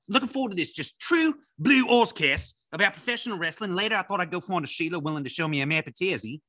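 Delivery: Australian accent, high and hammed-up; laconic outback drawl. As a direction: play an ocker Aussie bloke, Australian accent. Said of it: "Let me tell you just what I did today".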